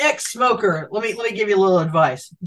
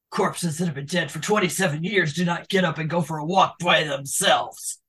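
gruff movie quote; 5 semitones lower, 5 dB quieter than usual. Retold or imitated. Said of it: "get down in the throat".

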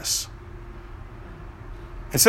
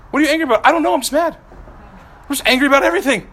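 whiny angry person; 7 semitones higher, much faster than usual. Acted. Acts out a grumpy angry person.

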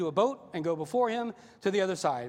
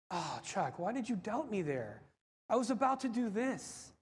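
softer, mildly let-down reproach; gentle chiding; 2 semitones higher, 4 dB quieter than usual. Acted. The Lord to Chuck in heaven, gentle chiding. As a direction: chide a friend softly.

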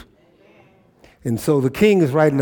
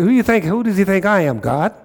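higher, indignant retold question; mildly upset, retold; 5 semitones higher than usual. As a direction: act out an offended king, lightly. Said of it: "And it upset him".

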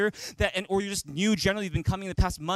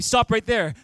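usual speaking voice

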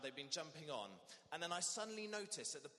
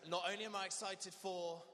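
bright, eager and louder, lifting on I; excited; 4 semitones higher, 5 dB louder than usual. Shown as a demonstration. Vicar leading the congregation, eagerly excited. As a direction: sell the excitement to the crowd. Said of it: "a little bit of hesitation on that one".

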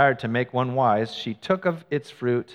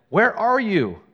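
higher, louder call; 8 semitones higher, 5 dB louder, slower than usual. Acted. God calling to the hiding man, raised call. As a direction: call across the garden.

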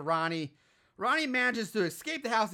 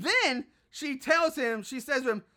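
ordinary delivery

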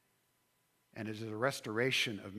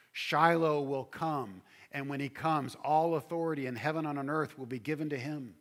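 proclaiming; 4 semitones higher, 6 dB louder than usual. Acted. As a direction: proclaim the coming king. Preacher rises to proclamation.

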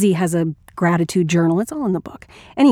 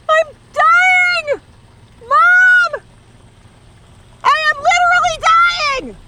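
very high, drawn-out shrieks; panicked screaming; 4 dB louder, much slower than usual. Acted. Calling Mom while sure of dying, high hysterical screams.

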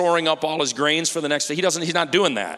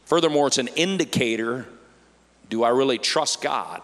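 ordinary talk

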